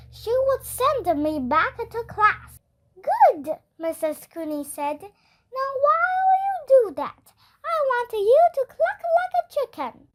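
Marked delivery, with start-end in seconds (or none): 3.1-3.6 higher, brighter nurse voice, quick; a cheery school nurse asking; lightly voiced friendly nurse; Missus Cooney voice. A narrator's reading. 5.6-10.1 higher, brighter nurse voice, quick; a cheery school nurse asking; lightly voiced friendly nurse; Missus Cooney voice. A narrator's reading.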